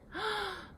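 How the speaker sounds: sharp high gasp of shock. Acted. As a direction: gasp at what she said. Father shocked by her words.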